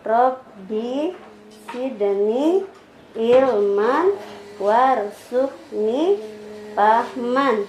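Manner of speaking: very slow, softened, drawn-out syllables; slow prayer recitation. Shown as a demonstration. Teacher leading child in prayer before study, very slow recitation. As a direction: lead a child through it piece by piece.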